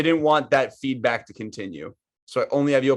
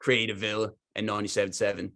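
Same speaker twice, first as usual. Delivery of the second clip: put-on light Australian accent for the game character; a hammed-up Aussie bloke, lightly voiced; in the Australian Fuse accent, plugging the podcast; 2 semitones lower, 3 dB quieter than usual. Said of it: "stick with the Fuse accent".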